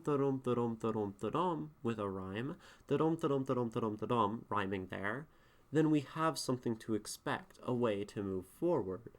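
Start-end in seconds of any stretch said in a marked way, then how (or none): 0.0-4.5 sing-song iambic chant; iambic da-dum rhythm. Shown as a demonstration. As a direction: chant da-DUM with the beat on the second syllable. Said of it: "iambic pentameter of da-dum".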